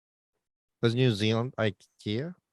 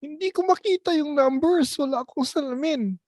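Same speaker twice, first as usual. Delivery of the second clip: high-pitched helpless elder, hammed up; mock grandparent; 13 semitones higher than usual. Joking with friends about old folks and technology, high mock-grandparent voice. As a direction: play a confused old relative, very high. Acts out elderly person without glasses.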